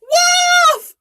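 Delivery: high, loud alarm shout; yell. Acted. Shepherd boy yelling to the village, high loud shout. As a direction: scream for help. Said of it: "the boy yelled".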